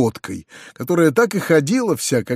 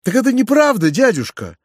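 higher-pitched, delighted disbelief; near-delighted cry; 8 semitones higher than usual. A narrator's reading. Nephew learns uncle keeps the village, near-delighted cry. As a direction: exclaim it high, almost in delight. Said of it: "вскричал я почти в восторге".

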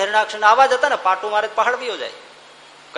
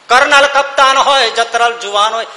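declaimed verse; 6 semitones higher, 6 dB louder than usual. Acted. Declaims verse refrain loudly.

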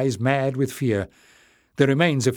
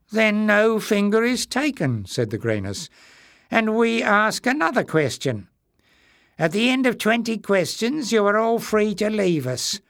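sly creature; 10 semitones higher than usual. A narrator's reading. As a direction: toy with the captive king. Greyness explains.

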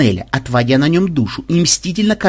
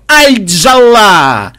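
very loud, slow, stretched-out yell; desperate battle cry; 6 semitones higher, 8 dB louder, much slower than usual. A narrator's reading. Macmed charging the boatman hand to hand, desperate loud yell. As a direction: charge in with a last desperate scream. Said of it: "с отчаянным воплем".

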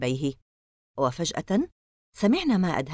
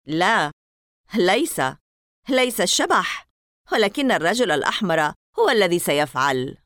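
ordinary delivery, nothing marked

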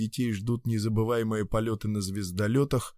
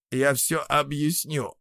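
metallic robot voice; 3 semitones higher, slower than usual. Acted. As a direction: speak like a slow metal robot. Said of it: "металлическим голосом робота".

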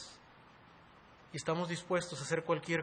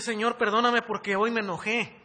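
louder, higher mock confession; acted prayer; 5 semitones higher, 8 dB louder than usual. Preacher voicing an easy prayer to the Lord, acted confession. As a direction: voice an easy apology to God, loudly. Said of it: "Es fácil decir".

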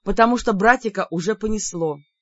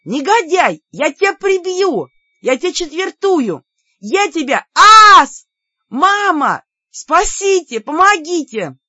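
high, loud threats and cries for help; frantic high-pitched yelling; panicked shouting; 8 semitones higher, 7 dB louder than usual. A narrator's reading. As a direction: scream protests as if cornered by a tickler. Героиня отбивается от щекотки, зовёт помощь.

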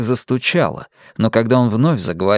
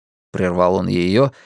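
ordinary delivery, nothing marked

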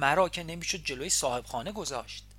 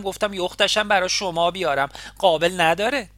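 put-on nosy neighbour in a thick Isfahani accent, higher and louder; 6 semitones higher, 7 dB louder than usual. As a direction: welcome her in broad Isfahani, a bit scolding. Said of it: "با لهجهٔ غلیظ اصفهانی".

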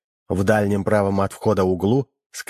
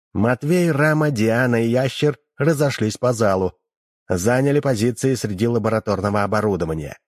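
ordinary delivery, nothing marked

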